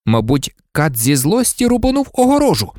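ordinary delivery, nothing marked

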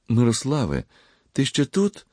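high, glad surprise; delighted, pitch lifted; joyful voice. A narrator's reading. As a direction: call out happily to a friend. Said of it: "пролунав радісний голос".

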